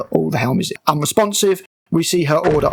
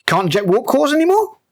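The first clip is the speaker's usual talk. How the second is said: mock the villain's plan with a pitched-up jab; sarcastic retort; 9 semitones higher than usual. Real, not acted. Mocking retort.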